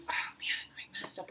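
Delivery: soft unvoiced whisper; whispered inner monologue. Shown as a demonstration. Teacher whispers the inner voice during the piano intro. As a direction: mutter it under your breath. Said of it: "you're going".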